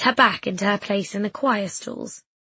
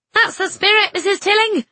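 much higher, loud cheer; 11 semitones higher, 7 dB louder than usual. A narrator's reading. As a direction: cheer her on with delight. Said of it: "Missus Quayle called".

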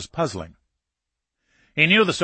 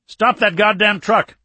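loud, high-pitched angry shout; zirytowany krzyk; 9 semitones higher, 6 dB louder than usual. A narrator's reading. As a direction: shout an urgent order to halt. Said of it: "Alexeyev screamed".

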